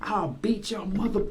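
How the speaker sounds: high mock threat, boss losing it; hammed-up furious boss; a hint of a boss ready to fight; retold angry boss. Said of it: "He was like".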